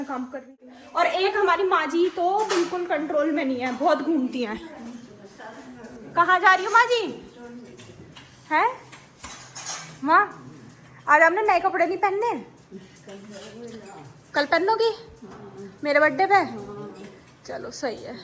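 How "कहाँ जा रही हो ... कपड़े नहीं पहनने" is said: louder, raised call across the room; loud call to mother-in-law. Real, not acted. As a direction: call out to her as she wanders.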